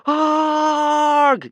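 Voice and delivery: very high, loud crowd yell; terrified yell. A narrator's reading. Whole tribe yells in fright at the corpse. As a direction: yell out in panic. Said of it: "yelled the rest of the tribe".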